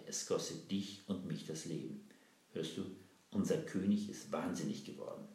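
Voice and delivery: plain reading voice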